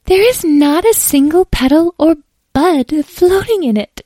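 high, loud fussy fairy; disdainful fairy. Acted.

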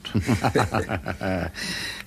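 a short low chuckle at the joke; amused laugh. Real, not acted. Lord chuckling at his friend's remark about the pavement, amused laugh. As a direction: laugh softly at the quip.

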